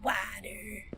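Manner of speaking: Water whispered, soft and breathy; hushed whisper of the word Water. Shown as a demonstration. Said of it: "if you say a word over and over".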